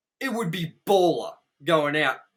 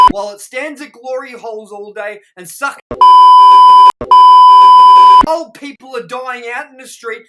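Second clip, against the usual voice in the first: high, loud, outraged tirade; furious rant; 20 dB louder than usual. Real, not acted. Host tears into a hated movie, furious rant. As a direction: let rip with escalating disgust. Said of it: "the more mad I'm getting".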